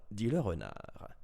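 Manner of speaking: ordinary delivery, nothing marked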